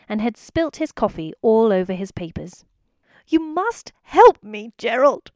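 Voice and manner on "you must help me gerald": higher, louder, slow pleading; desperate plea. A narrator's reading. Overworked wife begging her husband for help, desperate. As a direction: beg him as if it's the last hope. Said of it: "Missus G said desperately".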